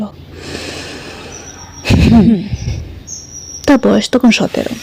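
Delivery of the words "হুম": a resigned sigh into a soft hum. A narrator's reading. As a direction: breathe out a defeated hum. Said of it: "দীর্ঘশ্বাস নিয়ে বলল".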